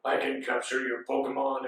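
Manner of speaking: low, measured villain voice; Mewtwo. Retold or imitated. Mewtwo to the failed trainers, low villain voice. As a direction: drop deep for a smug villain. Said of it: "And then he's like".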